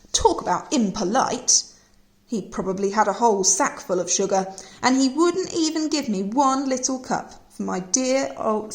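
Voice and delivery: ordinary delivery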